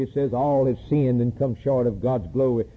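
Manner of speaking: normal talking voice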